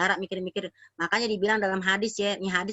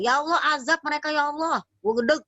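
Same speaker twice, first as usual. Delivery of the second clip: fed-up prophet's prayer; 7 semitones higher than usual. Acted. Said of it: "dia tinggal ngomong sama Allah".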